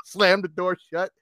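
ordinary delivery, nothing marked

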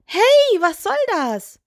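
very high, affronted doll voice; indignant. A narrator's reading. Doll objects to being insulted, indignant. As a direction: protest sharply in a high little voice. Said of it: "fragte Püppi empört".

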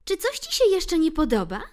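high loud cheeky mouse question; challenging mouse. Acted. Ixi presses Bill, high cheeky challenge. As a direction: press the retreating cat. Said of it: "zadała mu pytanie".

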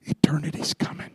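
hushed ominous whisper; preacher whispering of eternity. Acted. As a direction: breathe it out like a warning. Sermon whisper.

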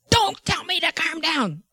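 acted indignant yell; retold angry outburst. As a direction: snap back as the furious patient. Voices the angry patient.

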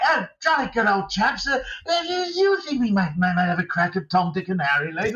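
high, stammering, mock well-meaning English officer; Richard Attenborough. Podcast guest mocking the film's main English guy, hammed-up dithering Englishman. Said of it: "he's all a bit like".

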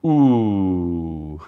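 long, low "ooh"; drawn-out impressed ooh. Real, not acted. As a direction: let out a low, lingering ooh at being corrected. Reacts to a student's correction.

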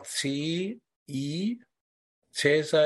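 "C I, Cäsar" spelled letter by letter with the German spelling alphabet. Shown as a demonstration. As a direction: spell "C I, Cäsar" with separate slow, clear letters. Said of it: "ich buchstabiere mal".

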